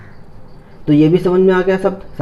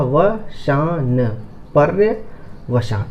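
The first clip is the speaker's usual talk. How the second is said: slow practice pronunciation; 2 semitones lower, 4 dB quieter, much slower than usual. Shown as a demonstration. Teacher drilling a hard word, slow and soft. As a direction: say वशान then पर्यवसान very slowly, every syllable clear. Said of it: "इसकी practice करते हैं".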